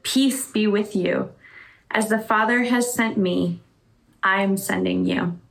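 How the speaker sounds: normal talking voice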